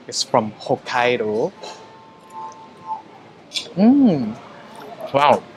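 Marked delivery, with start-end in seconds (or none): 3.8-4.3 high, loud appreciative hum; savouring mm. Real, not acted. Tasting the Hokkaido scallop for viewers, delighted hum.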